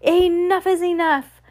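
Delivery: high, slow cut-in; young elder. A narrator's reading. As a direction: put a stop to it in a high, drawn-out voice. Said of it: "said one of the youngest elders".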